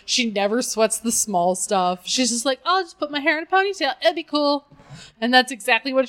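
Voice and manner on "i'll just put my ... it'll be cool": higher, quick, breezy delivery; lightly voiced carefree Barbie; unworried Barbie. Barbie shrugging off limp hair, lightly acted and unworried. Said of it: "she never panics about anything".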